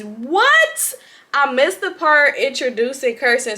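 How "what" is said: a high, loud disbelieving what; can't believe it; stunned what; shocked what. Real, not acted. Reacting to twist.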